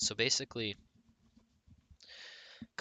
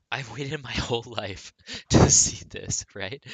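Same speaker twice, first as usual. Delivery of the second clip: "waited" said loud, laughing through it; 3 semitones higher, 11 dB louder than usual. Real, not acted. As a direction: laugh your way through "I've waited my whole life". Laughs through excited line.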